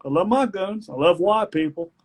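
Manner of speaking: put-on hamming up a country boy's speech; mock Southern speech. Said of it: "and I'm like".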